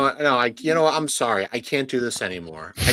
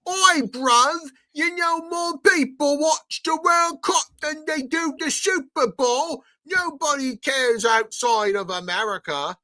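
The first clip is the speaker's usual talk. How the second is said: much higher mock British accent, taunting; 3 dB louder than usual. Mocking foreign fans about the World Cup, in a high mock British accent. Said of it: "It's like".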